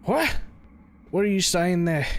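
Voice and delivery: voicing an old man, high and loud; put-on old man voice. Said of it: "what voice did I give this dude".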